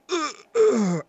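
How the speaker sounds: high, loud mock grunts; strained grunting. A man in the stall grunting loudly. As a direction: groan like a guy straining on the toilet. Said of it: "I just heard a guy go".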